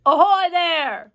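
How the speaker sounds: loud, long-drawn hail; police chief's booming call upward. A narrator's reading. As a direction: hail someone far above, high and slow. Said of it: "called the chief of police".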